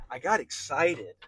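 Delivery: slow emphatic burst of excitement; excited, emphatic. Real, not acted. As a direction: let the excitement swell as you repeat it. Repeats the phrase for emphasis.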